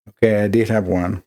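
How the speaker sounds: ordinary talk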